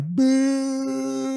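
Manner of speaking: long, high-pitched drawn-out boo; booing crowd. Acted. A crowd reacting to a failed play, booing. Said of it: "whole crowd's being like".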